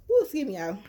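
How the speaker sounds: sour candy in the mouth; eating sour candy. Real, not acted. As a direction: excuse me around sour candy. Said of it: "I just got through eating some sour candy".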